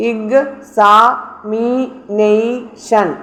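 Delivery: ഇഗ്, സാ, മീ, നെയ്, ഷൻ split with clear pauses; syllable by syllable. Shown as a demonstration. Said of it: "തൊട്ട് മുന്നിലുള്ള സിലബിളിൽ".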